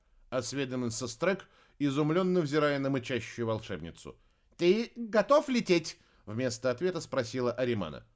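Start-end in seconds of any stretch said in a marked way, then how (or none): 4.6-6.0 urgent high question. A narrator's reading.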